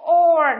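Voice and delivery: "Or" loud, isolated and held; vowel demonstration. Shown as a demonstration. Teacher answers how C is pronounced, modelling "Or". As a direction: sound out "Or" firmly. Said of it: "được phát âm là gì".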